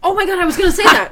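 high, loud and fast, bursting with recognition; excited outburst. Real, not acted. Eagerly cutting in because her friend stole her topic, excited. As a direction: blurt it out, thrilled the topic came up.

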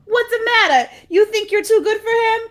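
high, loud indignant lady; high hammed-up outraged woman. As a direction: scold her like a huffy neighbour. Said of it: "says, quote".

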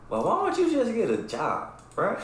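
high, mocking little-kid voice; cheeky kid's tone. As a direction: sass it like a smart-mouthed kid. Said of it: "it's how he said it".

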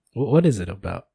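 mock-casual host pushing the question; forced natural host. Shown as a demonstration. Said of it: "that was me trying to sound natural".

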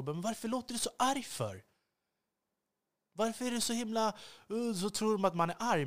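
a hint of baffled listeners questioning her; mock puzzled critic. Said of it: "när jag pratar med människor".

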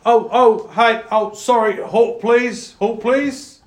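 high, loud call of halt from behind; a guard hollering after travellers to stop. Acted. A guard calls after passing travellers to halt, loud and high.

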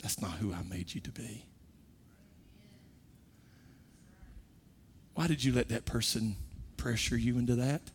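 God speaking to the heart at night, hushed; soft voice of God. Retold or imitated. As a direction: gently press the conscience. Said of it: "he'll say".

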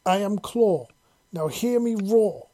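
rallying, drawing out the final word. A narrator's reading. Parser gaining confidence in the chant, energetically. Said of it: "said with more energy".

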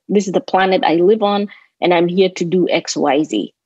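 normal talking voice

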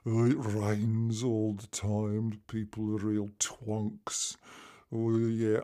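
slow, droopy Brummie moan; low, dreary Birmingham accent parody; downbeat Birmingham accent. Shown as a demonstration. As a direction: drag it down in a mock-gloomy Brummie accent. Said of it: "it's all down".

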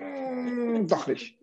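a drawn-out, hesitant "Mm, doch nicht" with a falling "doch"; hum, then waver; hesitant change of mind. Shown as a demonstration. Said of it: "sagt aber nicht mittendrin". (zögerlich umentschieden)

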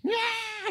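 very high squealed cry; imitated baby wail. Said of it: "he's freaking out over top".

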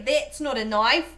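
drawn-out, loud mock Australian; Australian accent. Shown as a demonstration. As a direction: put on a broad Australian accent. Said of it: "I still can't do an Australian accent".

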